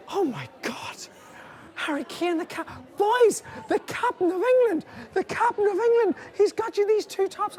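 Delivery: high, loud squealing wife voice; excited wife. Retold or imitated. Wife squealing over Harry Kane's gift, high and loud. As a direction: gush like she's starstruck by Harry Kane. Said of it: "She goes".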